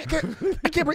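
high-pitched, strangled gasp of panic; imitated choking panic. Patient retelling his panic to friends, mock choking. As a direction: act out choking on a tube.

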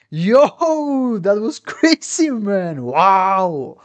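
loud, high-pitched hype with a laugh; real blown-away excitement, stretching the exclamations; amazed outburst. Real, not acted. Amazed reaction after the demos end.

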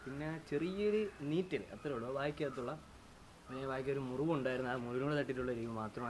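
ordinary delivery

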